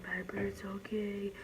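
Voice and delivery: a hushed whisper, like calming a shaking puppy; soothing whisper. Retold or imitated. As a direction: whisper it gently to a scared dog. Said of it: "and I would be like".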